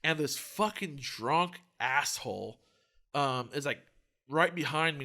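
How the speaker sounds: ordinary delivery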